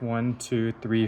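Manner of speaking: ordinary talk, nothing marked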